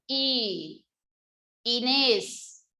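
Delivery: "Y", held, then a clear break before "Inés"; small pause. Shown as a demonstration. Teacher shows how a text begins, pause after "Y" before "Inés". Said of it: "empiezo con una pequeña pausa".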